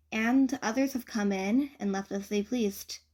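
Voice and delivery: ordinary delivery, nothing marked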